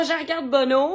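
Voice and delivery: ordinary talk, nothing marked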